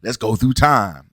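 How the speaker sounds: low, put-on accented movie-character voice; deep mocking character impression; Bishop impression. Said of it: "that Bishop's accent is horrible".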